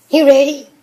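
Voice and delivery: high, loud excited question. Real, not acted. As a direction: pump up the dog. Excited talk to a dog.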